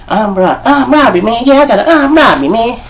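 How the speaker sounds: high, squeaky imitation; funny squeaky voice. Imitating the big inmate announcing his charge, funny squeaky voice. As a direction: pitch it up in a silly squeak. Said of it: "he had a funny voice".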